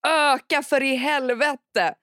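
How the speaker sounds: högre, långsamt utdraget ilsket rop; arg uppmaning. Retold or imitated. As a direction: dra ut orden i spelad ilska. Återger argt meddelande till försenade. (arg uppmaning)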